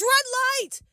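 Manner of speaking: high-pitched call at ordinary volume; mock a host calling a halt; imitated game-host yell. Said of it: "he yells".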